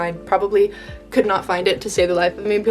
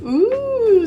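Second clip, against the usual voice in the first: excited; 5 dB louder than usual. Real, not acted. Excited reaction to own page.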